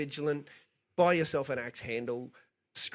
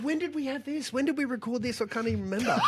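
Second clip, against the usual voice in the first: puzzled inner voice; 8 semitones higher than usual. Retold or imitated. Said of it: "I was thinking to myself".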